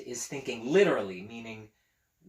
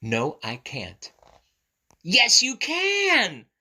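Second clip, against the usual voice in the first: lively picture-book read-aloud; reading to children; 7 dB louder than usual. A narrator's reading. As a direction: read it brightly to little ones.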